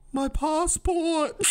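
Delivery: high, drawn-out mock cry of panic; goofy old woman's wail. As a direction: imitate a confused old lady wailing slowly. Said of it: "we just heard her go".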